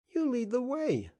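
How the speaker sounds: put-on high, excited little boy's call; the boy urging Beasley to start; child crying out. A narrator's reading. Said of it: "cried the child".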